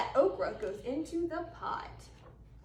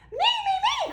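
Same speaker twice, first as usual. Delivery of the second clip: high, loud, laughing shout; eager cry; 7 dB louder, faster than usual. Acted. Possum shouting to be fed, high and excited. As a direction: beg to be picked first. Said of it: "cries Mademoiselle Possum".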